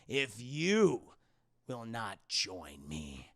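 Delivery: very slow, low sinister delivery; menacing Sith voice. Retold or imitated. Streamer voices the villain taunting Luke, slow sinister drawl. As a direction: draw out each word with menace. Emperor/Vader voice in long run.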